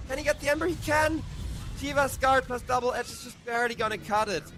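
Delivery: high, loud climax call; excited caster. Real, not acted. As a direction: yell it like the big play.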